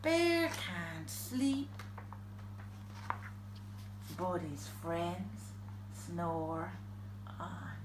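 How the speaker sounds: slow, fading to very soft; sleepy story ending. A narrator's reading. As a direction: wind the story down towards sleep.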